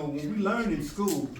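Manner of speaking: usual speaking voice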